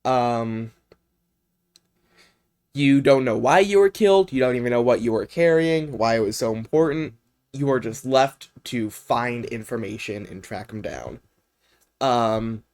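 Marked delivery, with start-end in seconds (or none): none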